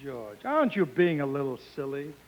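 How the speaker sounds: usual speaking voice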